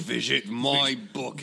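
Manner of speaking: slow, Scottish-tinged impersonation; a slow Scottish accent, Connery style; Sean Connery voice. Retold or imitated. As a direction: do Sean Connery selling the site. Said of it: "like a Sean Connery".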